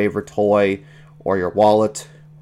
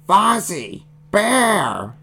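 high, wild creature outburst; blurted creature cry; interrupting little monster; 11 semitones higher, much slower than usual. A narrator's reading. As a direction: butt in like an excitable baby beast. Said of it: "interrupted Animal".

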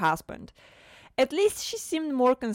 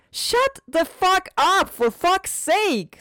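furious scream; 12 semitones higher, 6 dB louder, much slower than usual. Acted. Wife screams at husband.

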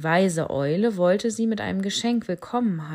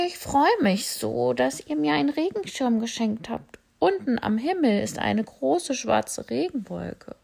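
higher, cheerful bat voice; 6 semitones higher than usual. Acted. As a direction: bat happily thanking the animals. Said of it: "Sie sagte". (hohe, fröhliche Fledermausstimme)

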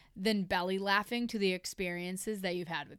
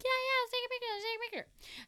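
eager agreement; 14 semitones higher, faster than usual. Retold or imitated. Drunk friend agreeing to take a picture, high and eager. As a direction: gush along in a mock high voice.

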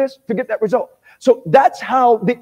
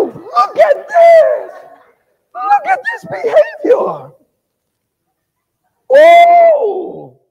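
much higher, louder, slow exclaim; mock amazement at the drunk; high mocking shout; 7 dB louder, much slower than usual. Preacher mocks a drunk's bold behavior, high shouting.

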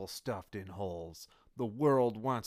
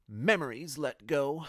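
usual speaking voice

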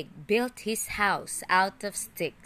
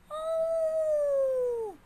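much higher, long held "Oh"; big bad wolf's call. A narrator's reading. Wolf calling at the straw house door, long high "Oh". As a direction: stretch the Oh like a sly wolf calling. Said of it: "he said".

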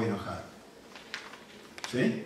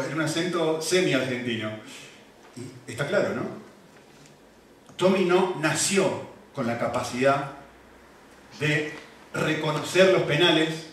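ordinary talk

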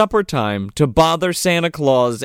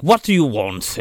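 lowered, lisping butler voice; 4 semitones lower than usual. Retold or imitated. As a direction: play an unwelcoming butler with a lisp. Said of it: "he talks like this, and he's got a lisp".

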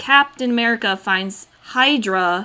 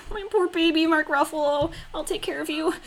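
higher, softer cooing with a laugh at the end; 7 semitones higher, 4 dB quieter than usual. Retold or imitated. Fan cooing over Mark Ruffalo's character, high, soft and laughing. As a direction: coo over him like a hurt pet. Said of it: "so I was like".

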